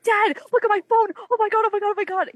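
high-pitched, frantic call to dad; excited screaming. Retold or imitated. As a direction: re-enact a squealing freak-out, breathless with excitement. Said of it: "I'm screaming".

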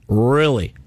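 low incredulous. Real, not acted. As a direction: drop the voice low, scoffing. Repeats it, deeper and mocking.

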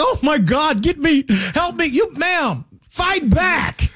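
high, mock-panicked pleading cries; mock panicked screaming. Retelling frightened passengers on a flight, high mock panic. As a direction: mimic frightened passengers shrieking for help, pitched high. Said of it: "start screaming and crying like".

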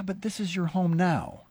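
ordinary delivery

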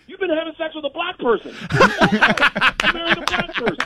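high, needling accusation at the husband; mock accusation. Needling a friend's husband at dinner, mock accusing. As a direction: jab at him like you caught him out.

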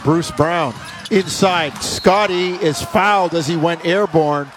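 brisk live game call; play-by-play. Acted. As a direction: call the action as it happens.

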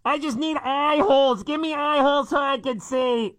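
high, muffled-sounding office lady; office lady stuck in box. Pretend. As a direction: play a trapped, flustered office worker. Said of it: "office ladies walking around the office".

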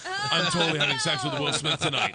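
laughing panicked shout; panicked outburst. Retold or imitated. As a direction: blurt it out in giddy panic.

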